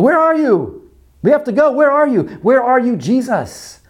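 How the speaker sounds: high, pressing cry of where are you; anxious pleading prayer. Acted. Acted anxious prayer to Jesus.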